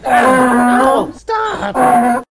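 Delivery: high, loud, drawn-out cry; hammed-up distressed protest; pleading outcry. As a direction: wail for them to stop. Pleading as a player is restrained.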